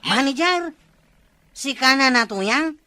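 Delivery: astonished that Nana Tuyang is a manager; shocked disbelief. Acted. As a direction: gasp out the question in disbelief.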